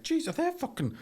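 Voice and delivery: high-pitched astonished squeal; a jump up in pitch on the shock; shocked squeak. Retold or imitated. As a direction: squeak it out in disbelief. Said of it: "'cause I was like".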